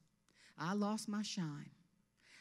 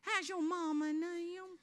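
put-on slow, high, sugary Georgia girl; put-on Southern sweetie voice; cutest little peach; 9 semitones higher, slower than usual. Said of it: "cutest little peach and say".

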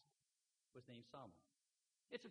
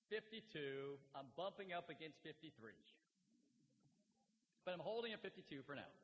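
usual speaking voice

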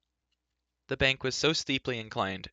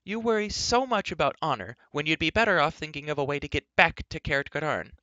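higher-pitched voice, chiding; exasperated telling-off in a higher voice; Elenwy, scolding; 6 semitones higher, 4 dB louder than usual. A narrator's reading. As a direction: scold them for wasting their worry.

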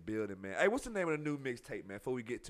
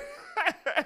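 very high laugh. Real, not acted. Host laughs at autograph story.

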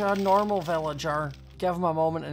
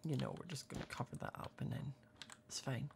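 guilty conspiratorial whisper; 2 semitones lower, 16 dB quieter than usual. Real, not acted. Gamer admitting she won't return for the bear, whisper. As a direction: hush it like a secret.

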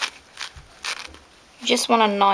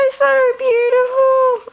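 so beautiful drawn out very high and louder; long, high, adoring so beautiful; swooning gush; 4 dB louder, much slower than usual. Real, not acted. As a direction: swoon over the doll, stretching the so. Gushing over the new doll.

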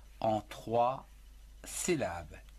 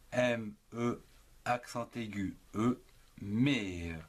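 normal talking voice

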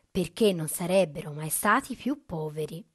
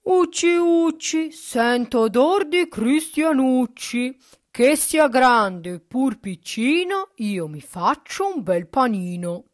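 loud, slow, booming ogre chant; hungry ogre; 5 semitones higher, 10 dB louder, slower than usual. A narrator's reading. Ogre to his wife as he smells a human, booming. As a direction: bellow it like a storybook ogre sniffing out prey. Ogre's rhyme in a fairy tale.

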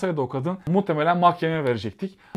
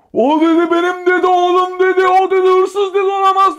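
high, loud outraged bellow; furious father; 14 semitones higher, 9 dB louder than usual. Retold or imitated. Father storming into the station to defend his son, furious shout. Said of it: "delire delire ... hışımla".

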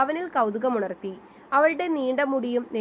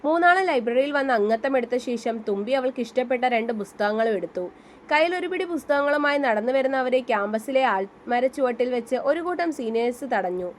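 ordinary delivery, nothing marked

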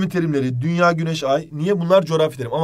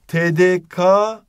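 "T D K" spelled out very slowly and louder; slow spelled letters; 2 semitones higher, 5 dB louder, much slower than usual. Shown as a demonstration. Teacher to students, spelling out slowly. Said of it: "T D K'nın dersen elveda".

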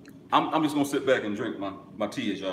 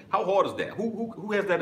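excited; 11 semitones higher, 3 dB louder than usual. Real, not acted. Challenging rhetorical question.

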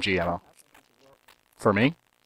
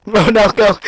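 frantic yell; 11 semitones higher, 5 dB louder, slower than usual. Real, not acted. Frantic shout while playing.

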